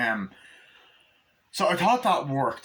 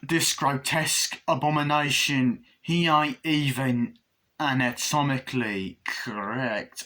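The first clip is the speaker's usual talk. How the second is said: slow, drawn-out stage delivery; put-on pompous announcing; showman presenter; slower than usual. Presenter showing off the grotesque doll, slow showman voice. Acting out the puppet show presenter.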